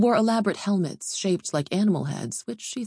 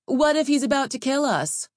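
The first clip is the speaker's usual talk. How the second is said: high-pitched, worried protest; anxious objection, pitched well up; alarmed fighter; 9 semitones higher, 4 dB louder than usual. A narrator's reading. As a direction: blurt it out in alarm. Fighter alarmed by deadly rules.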